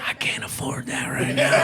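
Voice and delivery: hushed, sheepish aside; whispered excuse. Retold or imitated. Boyfriend whispering a dodge to his girlfriend. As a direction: whisper the excuse under your breath.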